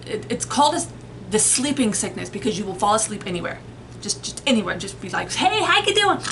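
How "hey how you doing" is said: bright, much higher hey; cheerful greeting. Retold or imitated. Narcoleptic mid-chat greeting, high and chirpy. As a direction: chirp a friendly hello, then drop off.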